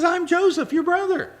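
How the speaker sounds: high, loud cry of revelation; emotional cry. Acted. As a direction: burst it out through tears of joy. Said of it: "And he says".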